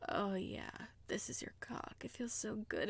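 unvoiced breathy whisper. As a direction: hush it close to the ear. Intimate whisper.

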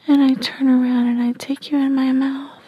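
ordinary delivery, nothing marked

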